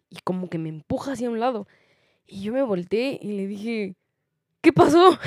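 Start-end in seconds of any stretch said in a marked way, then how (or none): 4.6-5.2 sharp, high, loud what happened; high loud startled comeback; startled retort. Retold or imitated. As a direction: snap back at the pushy lady in disbelief. Confronting lady who pushed her.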